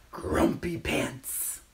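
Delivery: slow, drawn-out mock grump; playful grumpy. Storyteller giving children the book title, playful grumpy.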